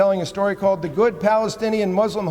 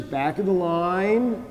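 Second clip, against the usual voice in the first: slow refusal; slower than usual. Retold or imitated. Peter's slow stern refusal.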